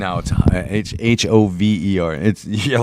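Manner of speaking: ordinary talk, nothing marked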